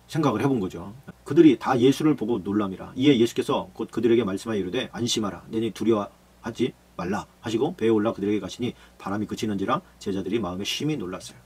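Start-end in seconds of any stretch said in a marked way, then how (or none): 4.9-7.3 low, slow, soft reassurance; calm them, slowly and gently. Acted. Jesus comforts, slow soft. (두려워하는 제자들을 낮고 느리게 안심시키는 예수님)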